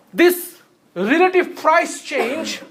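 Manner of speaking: slow, with "relative" stressed and drawn out. Shown as a demonstration. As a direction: lean on the word "relative", slowly. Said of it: "So the emphasis is on the word relative".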